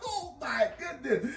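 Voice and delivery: long high yell trailing into disbelief; comic cry of disbelief; exasperated outburst. Real, not acted. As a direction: shout it out, then groan in disbelief. Reacts to being caught out again.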